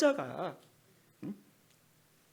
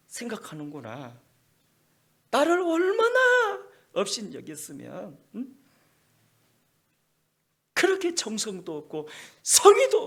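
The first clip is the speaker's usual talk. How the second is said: voicing an angry God, building to a shout; scolding the priests, rising to fury; God rebuking faithless priests, building to a shout; God's rebuke; 4 semitones higher, 11 dB louder than usual.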